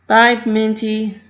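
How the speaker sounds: high, loud, slow cheery farewell; Pinky's goodbye. A narrator's reading. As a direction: sing out a drawn-out bye.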